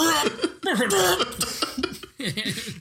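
hammed-up choking and spluttering; comic pretend choke; mock choking noises. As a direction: fake a choking fit, high and drawn out. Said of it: "allow me to pretend to choke on five pounds".

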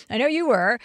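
usual speaking voice

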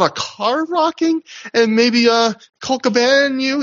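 high, hesitant imitation of timid prying; mock-meek, halting insinuation; meek Jimmy Jacobs. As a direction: raise the voice and ask gingerly. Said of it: "he's very meek here".

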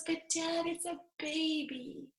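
put-on high, soft, slowing girlish voice; high-pitched tender wonder; pleading, delighted daughter. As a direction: voice a daughter coaxing her dad. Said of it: "But then she goes".

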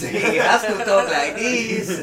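mock Latin accent, laughing; laughing, lightly put-on South American accent; generic South American accent. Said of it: "my accent and impression".

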